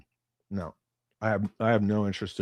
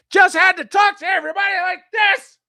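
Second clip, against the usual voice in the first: high shrill-free mock shout, much higher and louder; mocking loud Karen; 8 dB louder than usual. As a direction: bellow it like a pushy little Karen at the bar. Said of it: "like, five foot nothing... Karen haircut".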